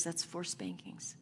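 soft, breathy sentence; hushed verdict. Retold or imitated. Dad as judge quietly sentencing his daughter, hushed. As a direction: hand down the sentence in a hush.

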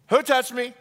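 high, loud demanding question; loud searching call. Acted. Jesus asking the crowd who touched him, loud high call.

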